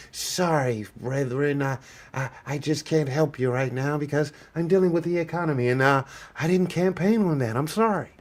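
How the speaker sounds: hushed, offhand mock apology; mock Trump brush-off. Streamer mocks Trump dismissing the representatives, hushed and offhand.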